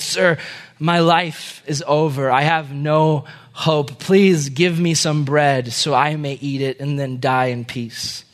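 slow, halting plea; weary beggar. Acted. Beggar begs the prince for a last piece of bread, weary and slow. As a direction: drag it out wearily, fading at the end.

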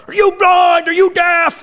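loud, high mock yell at the umpire; uncle yelling. Uncle yelling at the umpire over a bad call, loud. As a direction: yell like a furious dad at the fence. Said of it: "shaking it like".

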